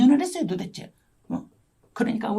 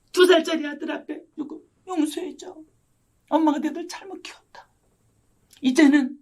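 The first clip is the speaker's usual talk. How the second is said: tearful apology; 6 semitones higher than usual. Retold or imitated. Mother kneeling before her small sons to apologise, tearful and remorseful. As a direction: relive begging forgiveness, voice held high and fading.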